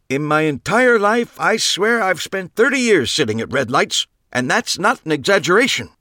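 put-on high, loud cranky old-timer; old man complaining. A narrator's reading. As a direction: gripe on and on like a grumpy old man. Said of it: "Max complain".

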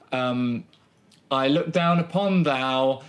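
usual speaking voice

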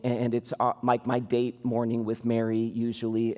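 ordinary talk, nothing marked

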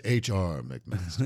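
low, slow antebellum Southern drawl; old-school Charleston gentleman's Southern accent. Retold or imitated. Imitating the South Carolina governor, antebellum drawl. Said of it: "He got that old antebellum accent".

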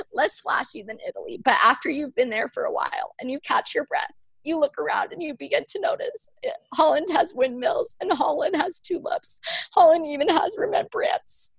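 a mother tearfully reading the poem; reading through tears. Real, not acted. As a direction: push on through real crying. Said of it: "I always cry".

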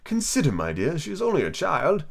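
mild, wavering-pitch plea; timid King. A narrator's reading. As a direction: plead gently as a timid husband. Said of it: "timidly said".

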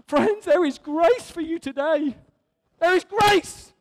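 shouted good news to the congregation; real exultant shout. Real, not acted. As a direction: proclaim it, building to a shout. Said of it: "it is worthy of shouting about".

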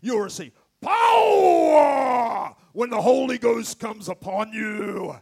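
loud drawn-out shouted power; Pentecostal preacher shout. Retold or imitated. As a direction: belt it like a revival preacher. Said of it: "how I used to hear it when I go to church".

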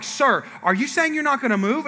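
high-pitched acted challenge; confronting wife. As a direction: imitate an indignant wife questioning a stranger.

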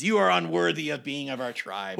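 hammed-up goblin condemning a traitor; menacing goblin. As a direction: snarl the verdict like a goblin guard. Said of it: "stabs him in the back and says".

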